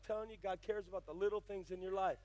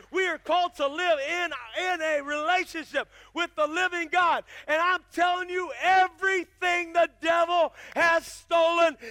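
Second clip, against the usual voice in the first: shouted, slow fiery preaching, much higher and louder; preaching at full force; 9 semitones higher, 15 dB louder than usual. Real, not acted. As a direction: thunder it from the pulpit, drawing each phrase out. Preaching climax.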